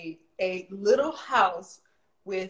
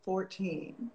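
ordinary talk

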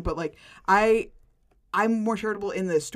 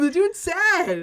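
mock pity; 10 semitones higher, 6 dB louder, much slower than usual. Real, not acted. Repeats line more slowly.

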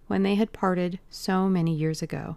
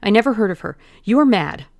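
louder, desperate denial in a rush of fear; frightened denial; 3 semitones higher, 9 dB louder, faster than usual. Acted. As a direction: deny it with everything, terrified. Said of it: "he stammered".

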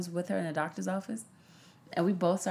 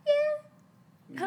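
high, light mom voice; mom's voice. Retold or imitated. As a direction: squeak it out like her mom. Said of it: "do your mom's voice".

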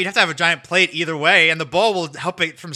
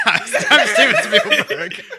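laughing through a joke name; laughing mock announcement; 6 dB louder, much slower than usual. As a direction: giggle it out. Joking impression of director.

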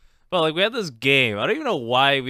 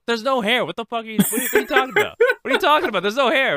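high, louder exasperated protest; imitated annoyed lady; 9 semitones higher, 4 dB louder, much faster than usual. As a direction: play the owner losing patience. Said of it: "the lady kept going like".

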